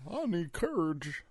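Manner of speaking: mock Lion-O. Friend joins the Lion-O bit.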